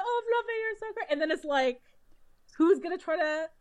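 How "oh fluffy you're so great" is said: high, sing-song coo at a pet; kids cooing at a dog. Retold or imitated. Imagining students greeting the giant dog, high mock coo. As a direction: gush over the dog in a much higher voice. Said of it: "the kids could have been like".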